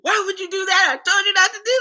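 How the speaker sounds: high-pitched, whiny, tearful complaint; hammed-up teary tantrum at the driver; mock mad whine. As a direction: wail it like a pouting child scolding. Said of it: "So I just got mad".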